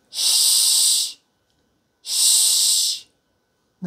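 シー as pure hushing air, no voice; voiceless fricative. Shown as a demonstration. Teacher drills number five for learners, シー as a voiceless hush. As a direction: blow a long, steady シー without any vowel. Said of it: "赤い印の方は無声音".

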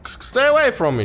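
high-pitched, startled demand; alarmed. Real, not acted. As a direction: warn off a creature, pitch jumping up. Creature approaches the player.

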